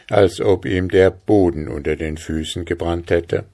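usual speaking voice

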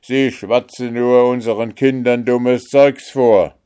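loud scornful guard; scornful call. A narrator's reading. Guard complaining about vagrants, loud scorn. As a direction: scoff at the rabble. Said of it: "rief einer der Wächter".

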